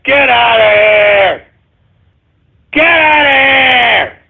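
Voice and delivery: long drawn-out scoffing shout; dismissive holler. Real, not acted. Scoffing at the anti-globalist claim, drawn-out dismissive shout. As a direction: stretch it out into a jeering holler.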